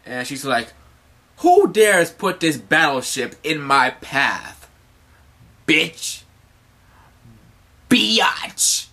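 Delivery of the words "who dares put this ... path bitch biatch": loud, slow haughty challenge, ending on stretched insult; imperious demand. Acted. Hancock challenges the battleship in her path, haughty. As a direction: declaim like an outraged queen.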